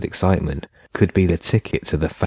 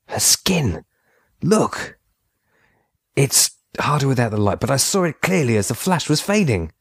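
excited; 7 semitones higher than usual. A narrator's reading. Pete excited at discovery.

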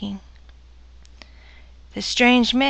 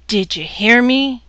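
loud angry roar; 5 dB louder than usual. Acted. Husband roaring at his silent wife, loud. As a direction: roar it at her. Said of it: "his voice roared".

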